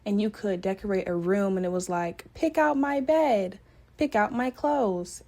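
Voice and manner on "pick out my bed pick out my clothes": higher, slow game-character prompt; lightly voiced game dinosaur. Dinosaur game asking the player to decorate, lightly voiced game character. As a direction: imitate a slow, high-pitched game prompt. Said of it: "a dinosaur".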